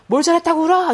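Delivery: imitating a parent's shrill scolding. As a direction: snap at the crying child like an annoyed parent. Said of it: "이러고 막 야단을 치죠".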